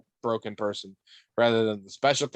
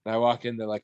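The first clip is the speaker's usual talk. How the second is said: usual speaking voice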